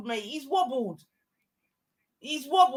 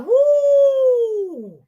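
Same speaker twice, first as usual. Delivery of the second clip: imitated woo; 11 semitones higher, 7 dB louder than usual. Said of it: "He's like".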